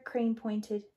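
usual speaking voice

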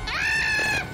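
high, loud shriek of fright; terrified scream. Real, not acted. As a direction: scream at the jump scare. Jump scare in horror game.

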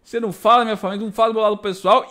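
imitating someone riled up and defensive; angry retort. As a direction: fire back indignantly, rushing the words. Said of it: "o Ed ficou puto".

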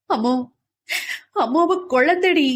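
high, loud tearful plea; clinging to her in tears; weeping helplessly; crying. A narrator's reading. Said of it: "Udayan அழுவதை".

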